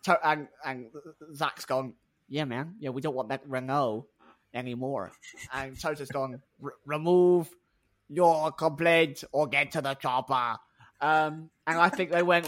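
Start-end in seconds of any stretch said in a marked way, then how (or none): 2.3-5.1 badly faked American, lower and softer; low, laid-back bad impression; Zak Brown. Team chief giving in to engine offer, badly faked low American voice. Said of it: "the Zak Brown impression was god-awful". 6.6-10.6 put-on slow, heavy Austrian action-hero accent; Toto, Austrian accent. Team boss ordering complaint withdrawn, hammed-up Austrian accent. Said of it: "It's Austrian, isn't he?".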